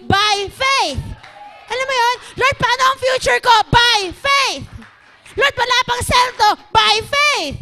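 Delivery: high, loud, building call and refrain; full-force preaching. Acted. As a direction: cry out each question, then punch "by faith". Preaches questions answered by refrain. (pasigaw at palakas na pangangaral)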